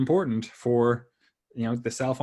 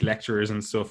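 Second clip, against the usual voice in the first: normal talking voice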